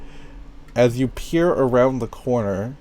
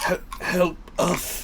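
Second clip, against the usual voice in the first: high, slow, faltering plea; weak plea for help; 6 semitones higher, 4 dB quieter than usual. Acted. Game master as victims calling for help, weakly pleading. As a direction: beg slowly for rescue, voice raised in pitch. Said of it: "you hear a voice say".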